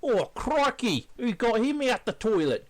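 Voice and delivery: high Australian wildlife-host voice; excited crikey Australian accent; Steve Irwin. Retold or imitated. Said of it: "This is Steve Irwin's day".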